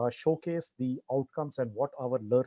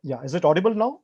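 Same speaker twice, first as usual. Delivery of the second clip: speaking up; 4 semitones higher, 10 dB louder, faster than usual. Real, not acted. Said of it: "You'll have to speak up a little".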